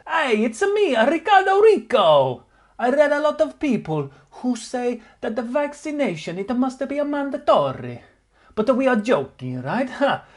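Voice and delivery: reading the cyclist's post in a high, hammed-up Italian voice; mock Italian accent. Vlogger reads out a doping cyclist's post, mock Italian accent. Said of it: "this has been translated in Google Translate".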